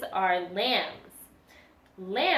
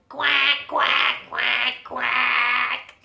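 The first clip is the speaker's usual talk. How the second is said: duck quacks; 2 semitones higher, 4 dB louder, much slower than usual. Acted. Mama duck quacking in song.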